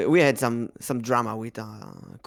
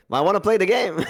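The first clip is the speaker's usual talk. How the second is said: higher, louder mock pleading; playful protest; 7 semitones higher, 5 dB louder, faster than usual. Gamer protesting sensible advice about hyped releases, playful protest. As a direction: insist like an impatient kid, laughing.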